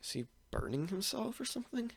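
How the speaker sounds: high, soft worried question. Acted. Kakashi watching Naruto clutch his heart, worried. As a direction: wonder anxiously, voice lifted and hushed. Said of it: "Kakashi's starting to get worried".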